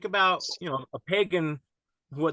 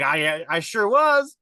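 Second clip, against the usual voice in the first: insistent; 7 semitones higher, 6 dB louder than usual. Retold or imitated. Insisting he was there.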